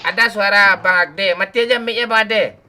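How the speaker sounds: loud, high call out; shouting. Real, not acted. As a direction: yell across to him. Shouting at friend about mic.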